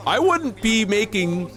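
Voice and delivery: hammed-up courtroom scolding; mock outrage. Mock courtroom tirade at chat.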